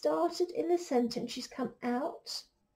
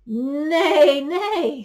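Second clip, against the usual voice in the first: neigh out loud like a horse; 14 dB louder than usual. Acted. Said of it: "said the horse".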